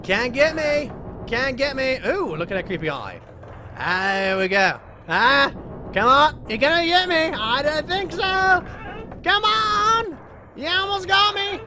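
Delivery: high, loud gleeful taunting; taunting chase yells. Real, not acted. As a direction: tease the monster as you dodge it. Taunting the chasing enemy.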